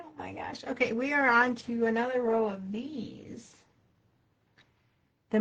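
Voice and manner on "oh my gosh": whispered relief. Real, not acted. Close call while stitching.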